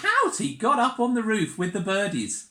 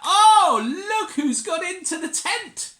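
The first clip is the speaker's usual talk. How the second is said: high, loud delighted oh; big surprise; 13 semitones higher, 9 dB louder than usual. Pretend. Showing the child the cow, a loud surprised oh. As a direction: burst out with a laughing oh.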